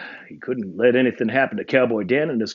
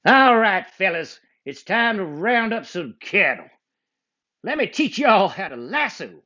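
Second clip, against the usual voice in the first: high, hearty cowboy twang; 9 semitones higher than usual. Acted. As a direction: teach like a friendly trail boss. Cowboy Dan speaking.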